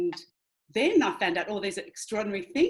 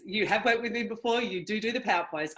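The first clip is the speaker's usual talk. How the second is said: ordinary delivery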